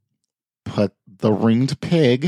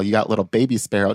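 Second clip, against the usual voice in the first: ordinary talk, nothing marked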